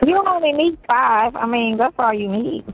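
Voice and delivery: normal talking voice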